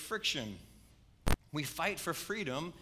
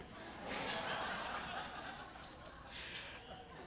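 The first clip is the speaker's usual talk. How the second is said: soft airy chuckle; quiet breathy laugh; 9 dB quieter than usual. Real, not acted. A pastor laughing at his son's logic, soft breathy chuckle. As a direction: let out a real quiet laugh. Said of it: "I just laughed".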